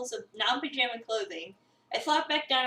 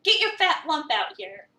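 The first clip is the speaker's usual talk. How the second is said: louder, higher call across the house; 6 semitones higher, 5 dB louder than usual. Acted. Owner calling his cat from across the apartment, raised call.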